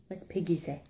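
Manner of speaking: soft whispered question. Real, not acted. Whispering to toddler.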